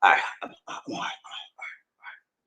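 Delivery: breathy whisper to himself; calming down. Real, not acted. Host reassuring himself after breakdown, calming down. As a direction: fade to a voiceless, settling murmur. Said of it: "calm down".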